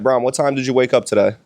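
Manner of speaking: usual speaking voice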